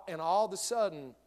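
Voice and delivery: ordinary talk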